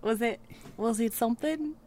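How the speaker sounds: softly put-on Australian accent; attempted Australian accent. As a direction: try a quiet Aussie accent. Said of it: "both trying to do Australian accents".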